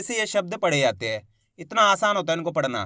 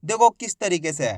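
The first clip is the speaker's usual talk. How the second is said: normal talking voice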